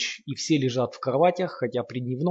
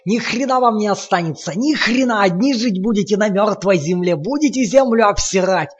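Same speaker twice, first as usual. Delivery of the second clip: frenzied ranting roar; 10 semitones higher, 9 dB louder than usual. A narrator's reading. Lomov ranting while beating.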